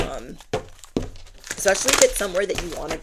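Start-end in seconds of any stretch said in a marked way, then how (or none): 1.6-3.0 louder and fast, especially pushed out eagerly; excited emphasis. Real, not acted. Urging viewers who love their home, loud and quick. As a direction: blurt especially if it's somewhere that you wanna be with energy. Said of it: "Especially if it is somewhere".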